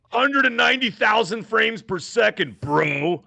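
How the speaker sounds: high, drawn-out mocking brag; mock boastful builder. Host mimicking PC gamers bragging about frame rates, mock boast. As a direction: stretch it out like an overhyped gamer.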